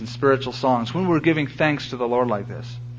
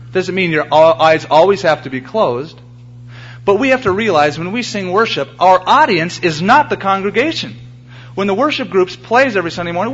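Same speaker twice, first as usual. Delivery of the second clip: louder, higher, driving pulpit voice; full-force preaching; 4 semitones higher, 4 dB louder than usual. Acted. Pastor urging the church to worship the Lord, preaching loudly. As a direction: press the point hard to the congregation.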